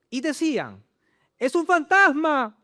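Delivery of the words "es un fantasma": loud, high, drawn-out cry of alarm; acted shout of fear at seeing a ghost; frightened cry. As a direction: read the disciples' cry as a scared shout. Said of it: "de miedo se pusieron a gritar".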